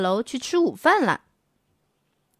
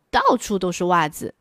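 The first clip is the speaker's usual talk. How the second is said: ordinary delivery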